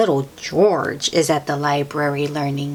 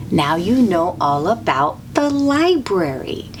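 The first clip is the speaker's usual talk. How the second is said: plain reading voice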